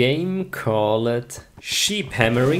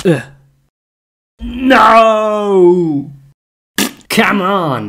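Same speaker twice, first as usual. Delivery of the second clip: much higher, loud yell of protest; frustrated shout; 8 semitones higher, 8 dB louder, much slower than usual. Real, not acted. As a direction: yell at the game in disbelief. Frustrated game failure.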